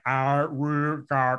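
arigato drawn out very slowly and slurred; imitating the wolf's clumsy arigato. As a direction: stretch arigato out slow and mushy like a wolf learning to speak. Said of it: "like, slurs it".

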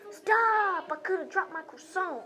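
high mock yell from a meme; imitated scream. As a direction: act out an outraged yell without full volume. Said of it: "he screams at him".

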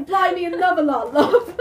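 high, loud cartoon British; over-exaggerated British accent. Shown as a demonstration. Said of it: "I like to do it over-exaggerated".

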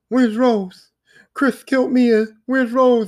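high, pleading impression of a frantic father; whiny mock desperation, pitched well up; mock distraught Ethan. Ethan asking after his baby after the crash, mock distraught.

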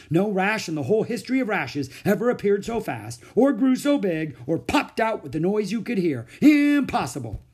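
from amazed to a loud, high cry of disbelief; astonished doctor building up. Acted.

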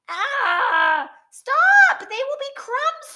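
high loud yell to stop; panicked shout. Acted. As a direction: cry out in alarm. Hippo keeps breaking the cookies.

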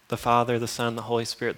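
usual speaking voice

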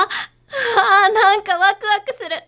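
high, loud thrill in the voice; bubbling over with excitement; excited. Acted. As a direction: squeal with delight at the idea.

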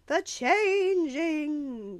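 high, long drawn-out taunting shout; shouting from below. A narrator's reading. Gally shouting up from below, loud drawn-out jeer. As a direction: holler it up the stairs, stretched out. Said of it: "Gally shouted from below".

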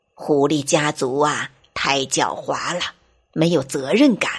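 low voice of a reproaching teacher, as remembered; remembered goat teacher. Acted. Little fox recalls goat teacher's words, low and reproachful. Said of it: "小狐狸想起了山羊老师的话".

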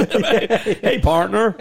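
laughing, put-on Texan hello; mock cowboy through laughter; mock cowboy greeting. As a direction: tip your hat, laughing. Mocking cowboy greeting while laughing.

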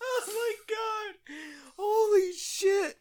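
high, slow squeal worn out by real laughing; helpless laughter. Real, not acted. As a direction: squeeze it out at the tail of a laughing fit. Recovering from a long laughing fit.

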